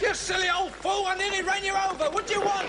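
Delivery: put-on high-pitched, rude telling-off of an old fool. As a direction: snap at him as if he nearly got run over. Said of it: "Don't take that tone with me".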